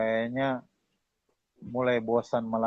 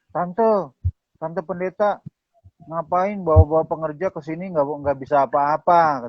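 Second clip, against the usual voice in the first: louder, raised tattling voice; mock complaint; 3 semitones higher, 4 dB louder than usual. Congregant complaining to the pastor about a useless worker, mock indignant. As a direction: imitate a churchgoer griping to the pastor. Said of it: "jemaatnya ngadu ke gembalanya".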